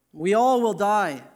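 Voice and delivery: slow line with a firm stress on "all". Shown as a demonstration. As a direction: lean hard and long on "all". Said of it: "Notice the emphasis on the word all".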